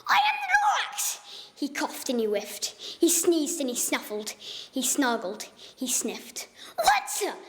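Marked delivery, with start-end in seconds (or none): 0.1-1.2 high whispered gripe; griping Lorax. Acted. Lorax returning with gripes, high whispered complaint. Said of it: "came back with more gripes". 6.8-7.3 high breathless croaking cry; croaky cry. Acted. Lorax coughing in the smog, high croaking cry. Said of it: "he cried with a crofulous croak".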